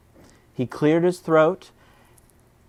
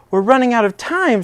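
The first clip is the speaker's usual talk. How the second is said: high, loud hurried worry; urgent and alarmed; panicked; 8 semitones higher, 5 dB louder than usual. Acted. Squirrel to friends, loud and high panic.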